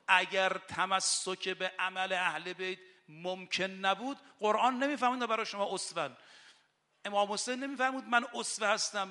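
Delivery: loud, impassioned rowza sermon; rising impassioned sermon voice; fiery preaching. Acted. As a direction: preach it out with full fervour. Impassioned preaching about duty.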